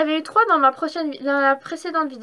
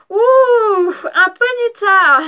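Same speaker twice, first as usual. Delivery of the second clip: a loud, high delighted gasp at the card; thrilled reveal of a Ponyta, drawn out; excited; 5 semitones higher, 10 dB louder, much slower than usual. Real, not acted. Vlogger reveals a card to viewers, loud delight.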